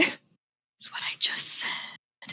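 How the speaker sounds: slow, unvoiced whisper. Real, not acted. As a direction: whisper a dry aside, slowly. Amused aside to the table.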